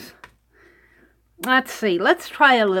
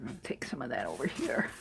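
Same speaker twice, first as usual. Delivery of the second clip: whispering; 11 semitones lower, 16 dB quieter, faster than usual. Real, not acted. Whispered aside.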